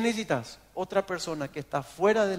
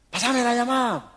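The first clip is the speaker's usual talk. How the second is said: orden aguda y tajante, a gritos; jefe gritando, imitado; 8 semitones higher, 4 dB louder than usual. Retold or imitated. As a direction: imita el estallido de un jefe harto. Jefe pierde la paciencia. (grito de jefe enojado)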